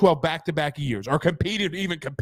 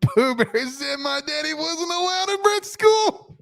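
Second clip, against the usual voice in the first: high-pitched words squeezed out through real laughter; barely getting it out for laughing; slow, breathless laugh-talk, voice pitched up high; laughing hard; 8 semitones higher, slower than usual. Real, not acted. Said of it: "I'm literally crying".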